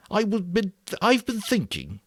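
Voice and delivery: put-on high, earnest fat boy voice; a fussy, eager boy explaining; Piggy voice. A narrator's reading. Piggy's voice in audiobook.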